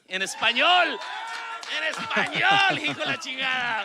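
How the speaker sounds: high, very loud Spanish exclamation; hammed-up Spanish shout. Host capping the tongue twister, mock-fierce shout in Spanish. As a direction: belt it out like a Spanish-language showman.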